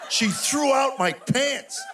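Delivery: high-pitched, drawn-out comic outrage; indignant disbelief. Retold or imitated. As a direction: stretch it out slowly in appalled disbelief. Comic outrage at discovered pants.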